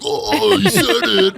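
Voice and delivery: high mock gasp, voice cracking up with a laugh; a mock-shocked squeal; squeaky mock voice. A comedian squealing to tease the Canadian sorry.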